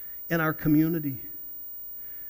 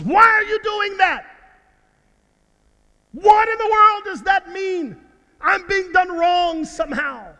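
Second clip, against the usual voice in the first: hammed-up indignant shouting, much higher and louder; mock outrage; 11 semitones higher, 8 dB louder than usual. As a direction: play an offended crowd yelling in exaggerated outrage. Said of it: "Our response is".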